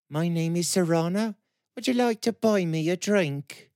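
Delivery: put-on gentle rural lilt, high girl's voice; soft country girl voice; soft country burr. A narrator's reading. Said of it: "The voice had a soft country burr to it".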